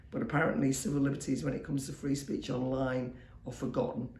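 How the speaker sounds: usual speaking voice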